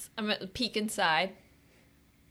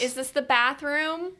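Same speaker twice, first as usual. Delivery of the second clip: calling out; 7 semitones higher, 3 dB louder, slower than usual. Acted. Calling through a closed door.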